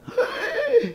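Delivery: high, sharp intake of amazement; mock delighted gasp. Believer hearing the angel's greeting, thrilled gasp. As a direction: gasp as if overwhelmed by good news. Acting our delighted reaction.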